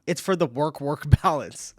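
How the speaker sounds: laughing through the words. Real, not acted. Reading a viewer's joke aloud, laughing. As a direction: crack up while reading the line out.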